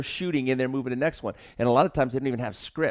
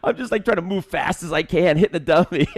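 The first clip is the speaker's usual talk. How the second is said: high, loud, laughing exclamation; laughing disbelief; 9 semitones higher, 6 dB louder than usual. Real, not acted. Actor recalls improvising on the dummy, laughing disbelief. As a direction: burst out laughing as you tell it. Laughing over his own botched take.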